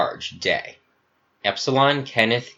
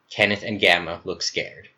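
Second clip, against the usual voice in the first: ordinary delivery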